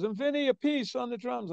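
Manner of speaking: high-pitched imitation of a rock frontman. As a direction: introduce the drummer to the crowd, high voice.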